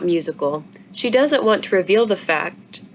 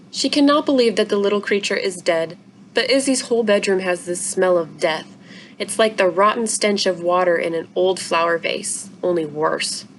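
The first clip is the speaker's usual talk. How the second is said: ordinary delivery, nothing marked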